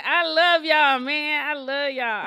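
loud, gushing love to listeners; loud affectionate. Real, not acted. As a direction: shout your love to the crowd. Greeting listeners after hangover mention.

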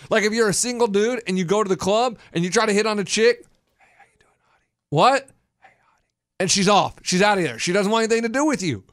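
ordinary delivery

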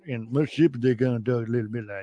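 quick, run-together Mississippi accent; Mississippi mumble. Shown as a demonstration. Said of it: "They mumble a little bit more in Mississippi".

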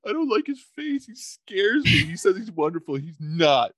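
hammed-up baby tattling to mom about a scary man; scared baby voice. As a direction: whimper it up high like a frightened baby. Voicing the baby scared of Wonderful.

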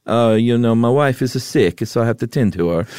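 put-on Italian accent, slightly lifted. Man excusing himself to immigration, mock Italian accent. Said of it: "Everybody loves your Italian accent".

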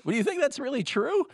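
squeaky high disbelieving what; incredulous what. Retold or imitated. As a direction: act shocked, voice jumping way up. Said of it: "And I'll be like".

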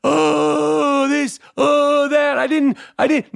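high, whiny mock complaining; whiny complainer. Mocking fans who DM him complaints, high whiny mock. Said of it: "DMing me".

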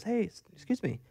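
a lightly voiced nosy woman, soft and high; imitating the neighbor. Neighbor voiced in retold story.

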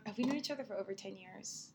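normal talking voice

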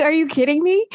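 high, laughing disbelief; laughing in shock at how it turned around. Retold or imitated. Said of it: "I was like".

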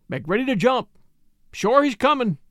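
urgent call. A narrator's reading. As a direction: call out the alarm to the others. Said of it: "called Jim".

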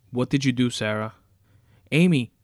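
frantic shouting. Acted. Captive husband shouting at Sarah and calling Amy, loud and frantic. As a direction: shout at her, then scream the name.

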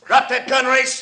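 high, loud barked command; shouted threat. Acted. Fogel threatening Race from the stairtop, shouting. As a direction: yell it down the stairs at him.

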